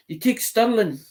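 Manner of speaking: put-on a light Irish accent, repeating the question. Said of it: "in his Irish accent".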